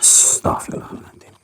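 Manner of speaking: soft whispered astagfirullahaladzim; whispered prayer. Real, not acted. Ghost hunter hearing the crying spirit, whispered prayer. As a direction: murmur it in awe.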